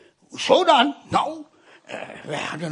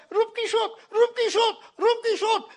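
panicked calling; 13 semitones higher than usual. Retold or imitated. Sick boy calls for servant.

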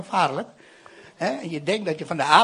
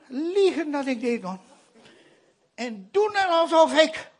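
normal talking voice

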